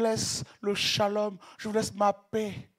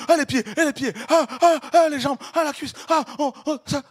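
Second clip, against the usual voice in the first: louder, higher breathless ahs of wonder; ecstatic sighs; 6 semitones higher, 10 dB louder than usual. Real, not acted. Preacher gazing at the glorious body, ecstatic breathless ahs. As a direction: gasp out each part in delight.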